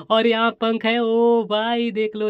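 slow, high, excited look-at-this; excited showing off. Real, not acted. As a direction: show it off with delight. Showing off the insect eraser.

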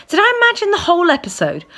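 incredulous. A narrator's reading. Disbelief at the theft.

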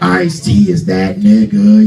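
low, loud, slow rapper voice; [name]. Retold or imitated. Comic praising [name] in his voice, deep slow impression. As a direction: growl it slowly, deep and forceful. [name] imitation after naming him.

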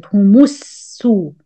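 slow, Humussu with the mim as damma and a long s; slow Quran recitation demo; joined mim with damma. Shown as a demonstration. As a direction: draw out Humussu slowly, feeling the sin. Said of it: "change the meme into Dhamma".

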